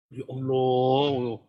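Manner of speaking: very slow drawn-out Ya Allah; a hammed-up casual invocation; mock sigh of prayer. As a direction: stretch it out as a lazy exclamation.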